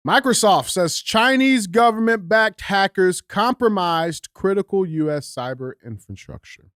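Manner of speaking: slow, punched-up news headline; headline read. Acted. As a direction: start high and loud, easing down by the end. Reads out the headline.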